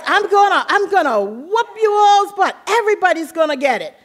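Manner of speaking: high mock-threatening mother; imitating mom promising a whooping; angry mom. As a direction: mom scolding the kids over broken dishes, high mock threat. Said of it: "And she says".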